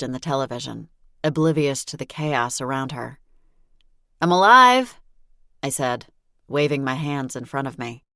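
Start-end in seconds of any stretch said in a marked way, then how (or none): 4.2-5.0 loud, higher announcement. Acted. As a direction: call it out brightly. Announcing herself to the family.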